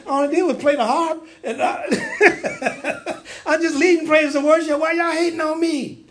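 high-pitched comic plea of innocence; mock-hurt whine, cracking up; laughing protest. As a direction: protest playfully through laughter. Voices persecuted David, laughing.

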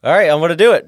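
psyching himself up. Retold or imitated. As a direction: pump yourself up to face icy water.

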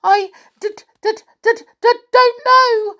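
very high, loud, panicky stutter; terrified little pixie; stammering pixie. A narrator's reading. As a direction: stutter helplessly before blurting it out. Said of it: "stammered the poor pixie".

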